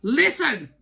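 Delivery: loud, sharp order from the King; commanding shout. Acted. King of Swords card commanding the reader. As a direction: bark it like a stern king. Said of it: "I'm gonna say it like that".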